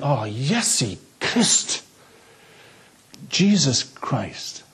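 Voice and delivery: drawn-out, slow "Iesu Christ" then "Jesus Christ"; Welsh curse. Retold or imitated. Welsh Valleys locals cursing, slow Welsh "Iesu Christ". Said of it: "people saying".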